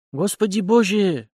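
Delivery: slow, high groan of horror; moaning in dismay. A narrator's reading. Youth realising what he has done, moaning. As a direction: drag it out high as the blunder sinks in. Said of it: "простонал начавший понимать, что именно он натворил".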